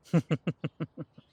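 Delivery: a quick light laugh, breathy and bright; short laugh. Acted. Companion laughing to the player, short light laugh. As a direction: laugh it off for a beat.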